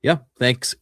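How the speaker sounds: ordinary talk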